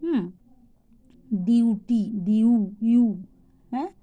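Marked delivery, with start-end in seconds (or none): 1.5-3.0 ডিউটি broken down to a loud "you". Shown as a demonstration. Teacher showing U pronounced "you", loudly, in ডিউটি. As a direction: stress the "yoo" in ডিউটি, then isolate ইউ. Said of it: "ইউর উচ্চারণ ইউ হয়".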